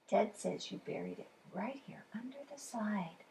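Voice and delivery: hushed secret whisper. Acted. Child passes Ted's secret.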